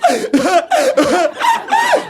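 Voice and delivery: high loud wailing howl; hammed-up sob-laugh wail; mock wailing sob. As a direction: wail like a blubbering mess. Imitating his own crying on plane.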